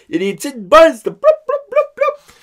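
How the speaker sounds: put-on silly child voice. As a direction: play the giggly little boy. Imitating son's silly sound. (voix d'enfant très aiguë)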